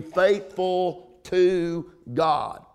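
FAITHFUL TO GOD drawn out, word by word; slow right down and weigh "faithful to God"; slow emphasis. Real, not acted. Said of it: "I slowed down and said that with emphasis".

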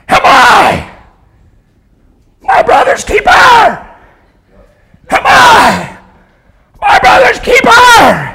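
high, raspy scream in drawn-out bursts; Cain screaming. Acted. First murderer crying from hell, raspy screaming. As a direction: cry out as if after ages of screaming. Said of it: "a voice raspy from six thousand years of screaming".

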